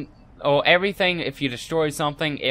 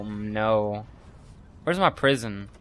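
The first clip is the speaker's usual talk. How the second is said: ordinary talk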